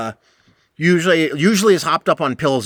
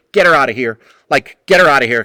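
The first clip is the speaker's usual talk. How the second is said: louder, pressing demand; urgent order; 7 dB louder, faster than usual. Retold or imitated. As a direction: insist he take her away now.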